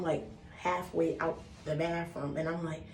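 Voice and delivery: ordinary talk, nothing marked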